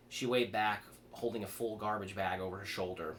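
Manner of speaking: plain reading voice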